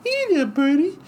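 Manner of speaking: high Tyson voice, light and friendly; Tyson impression. Tyson greeting the cockatoo in a high voice. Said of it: "Joan Embry".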